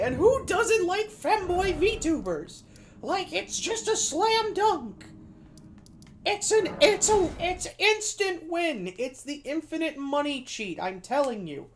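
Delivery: very high, drawn-out gushing; gushing mock hype. Streamer hyping femboy VTubers, high-pitched gushing. Hyping up his own quote.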